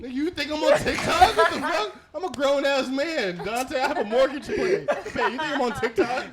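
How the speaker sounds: high laughing shout; laughing yell. Real, not acted. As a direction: yell it while cracking up. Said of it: "You got me yelling and shit".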